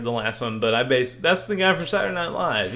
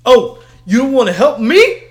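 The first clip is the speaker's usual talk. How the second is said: loud, high, whiny outrage; mock-offended shout; indignant customer; 9 semitones higher, 12 dB louder than usual. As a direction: play the offended customer threatening to tell the boss. Customer complaining about alarm.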